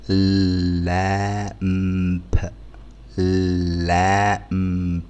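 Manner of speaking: لامب with the vowel held long, no b; very slow lamb. Shown as a demonstration. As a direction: draw out the aa of لامب very slowly. (مد حرف الألف في لامب)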